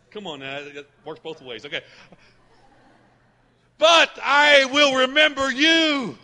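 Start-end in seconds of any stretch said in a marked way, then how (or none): 3.8-6.2 slow, high, loud declaration; psalmist's resolve. Acted. Psalmist choosing hope despite sorrow, loud and slow. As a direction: proclaim it slowly and loudly as a vow. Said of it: "See, he's choosing".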